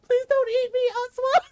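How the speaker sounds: put-on high, loud begging in a child's panic; mock shrieking. Little brother begging the aswang, mock shrieking. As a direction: plead for your life like a terrified boy. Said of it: "and he's shrieking".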